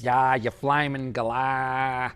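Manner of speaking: slow, broad Australian drawl in a put-on soap character voice; mock cranky Australian drawl; Alf from Home and Away. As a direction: play a grumpy old Aussie soap character with a broad accent. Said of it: "I got that from Alf in Home and Away".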